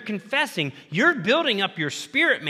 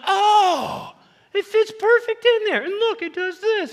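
much higher, wide-eyed delighted voice; delighted kid; 4 dB louder than usual. Retold or imitated. Preacher voices Josh discovering the wrench fits, delighted and high. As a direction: play a boy thrilled by a wrench that fits. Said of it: "Josh is like".

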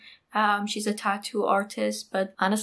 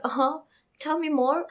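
higher-pitched, mock-irritated prompt; mildly angry retort; 6 semitones higher than usual. Angry friend daring the speaker to continue, mildly irritated. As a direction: retell an angry challenge, voice lifted. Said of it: "she was getting really angry".

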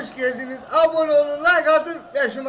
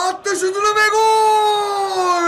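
drawn-out shouted goal call; 7 semitones higher, 4 dB louder, much slower than usual. Acted. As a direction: stretch out the goal, rising. Calling a goal in the match.

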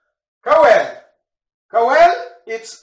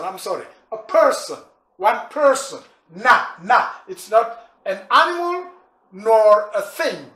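ordinary talk

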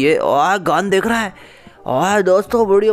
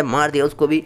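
ordinary talk, nothing marked

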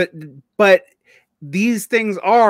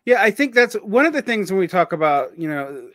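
usual speaking voice

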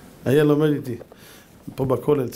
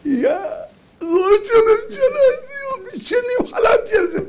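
very high, put-on swooning delight; mock ecstatic squeal; 14 semitones higher than usual. Leader dreamed for years of the title, mock ecstatic squeal. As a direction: squeal it like a dream come true, hammed-up.

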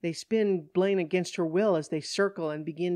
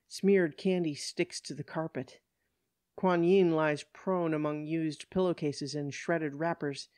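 plain reading voice

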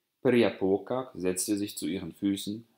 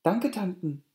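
put-on higher girlish thanks; lightly voiced young girl; young girl thanking; 10 semitones higher than usual. A narrator's reading. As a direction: thank the aunts politely, a girl's voice. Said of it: "erwiderte da Periapokak".

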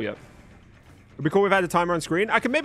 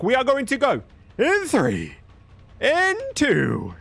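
countdown; 6 semitones higher, much slower than usual. Acted. Counting down the game start.

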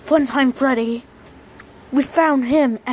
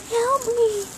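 squeaky plush cry; 10 semitones higher, 3 dB quieter than usual. Pretend. Plush begs for help.

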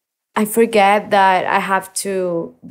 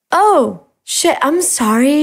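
embarrassed surprise; 6 semitones higher, slower than usual. Retold or imitated. Embarrassed apology to cashier.

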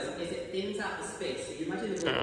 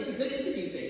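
ordinary talk, nothing marked

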